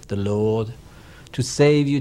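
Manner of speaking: ordinary delivery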